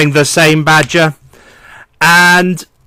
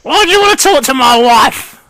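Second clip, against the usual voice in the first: put-on high, reedy old gnome voice, henpecked; old gnome mishearing superior as wife, high and fretful; old gnome lord; 8 semitones higher than usual. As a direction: whine it up high like a fussy old lord avoiding his wife.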